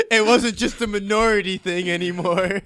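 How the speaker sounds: loud laughing that spills into the words. Real, not acted. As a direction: laugh hard, still getting the words out. Laughing at George finally caught.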